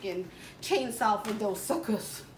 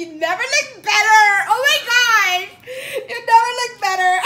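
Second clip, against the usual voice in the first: mock crying; 10 semitones higher, 13 dB louder than usual. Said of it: "I kinda wanna cry right now".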